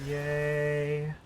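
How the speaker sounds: usual speaking voice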